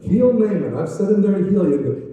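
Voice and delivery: loud, high commanding proclamation; booming royal decree. Acted. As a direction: announce the king's order at full voice. King's urgent message, preacher acting.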